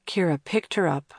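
ordinary delivery

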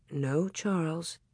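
soft, slow murmur. A narrator's reading. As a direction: murmur it gently. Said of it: "Ma murmured".

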